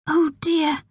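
high-pitched, concerned sigh of words; dismayed sympathy. A narrator's reading. Kathy helpless before crying Pearl, dismayed sympathy.

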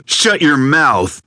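slow, high, loud command; snapping order. Acted. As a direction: cut him off cold. Armed man cuts Randy off.

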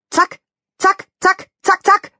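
high, short "zack" jabs; stabbing sound words. Acted. Donald Rabbit spearing meatballs, high rapid jabs. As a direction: jab out each word like a fork strike. Fork stabs acted as sounds.

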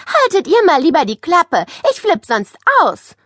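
loud, high, irritated telling-off; annoyed. A narrator's reading. Girl telling two teasing boys off, annoyed. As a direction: warn them you are about to lose it.

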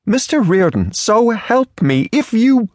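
high, building from haughty to sputtering; prim lady rising to fury. Acted.

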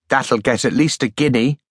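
ordinary delivery, nothing marked

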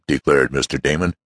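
ordinary delivery

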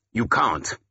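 mildly sharp rebuff; understated, curt no; sharp refusal. Acted. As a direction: cut the request off sharply. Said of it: "Wulfhere said sharply".

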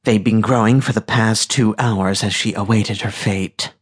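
plain reading voice

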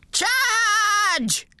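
rally the troops; excited battle cry. A narrator's reading. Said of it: "came Gramps' excited voice".

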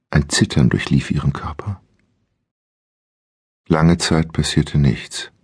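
ordinary delivery

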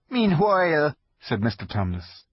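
high, timid fawn voice; nervous polite faun; shy fawn voice. Acted. Fawn character voice.

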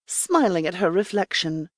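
ordinary delivery, nothing marked

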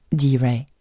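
usual speaking voice

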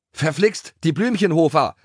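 high, exclaimed curse; annoyed call. A narrator's reading. Rider Dennis spots the rival yard, annoyed call. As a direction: call out in vexation. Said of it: "rief Dennis".

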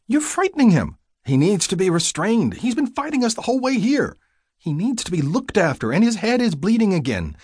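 high-pitched, urgent protest; agitated arguing. Acted. Carers arguing over a struggling, bleeding patient, agitated and high.

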